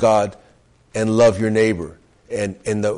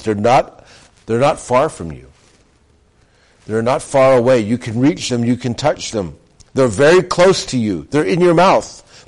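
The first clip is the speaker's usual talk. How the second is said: rising from plain to high and loud; building preaching; 3 semitones higher, 4 dB louder than usual. Acted. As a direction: build the scripture to a ringing peak.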